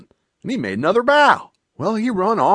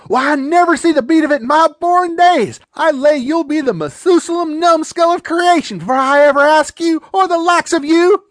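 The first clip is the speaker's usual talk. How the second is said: loud, higher scornful scolding; scornful outrage; 6 semitones higher, 6 dB louder than usual. Acted. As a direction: sneer at the very idea. Aunt mocks and rejects him.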